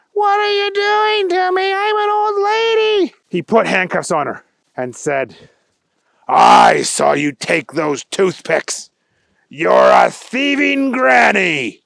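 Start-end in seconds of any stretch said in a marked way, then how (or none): 0.1-3.1 put-on high, shrill-pitched old granny wail; screaming old lady. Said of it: "She was very loudly screaming". 6.3-11.8 slow, loud gloating accusation; stern accusation. Acted. Agent arrests old lady.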